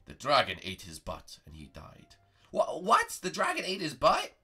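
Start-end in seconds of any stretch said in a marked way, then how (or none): 0.1-2.2 wise master voice. Acted. Master Prego tells a tale.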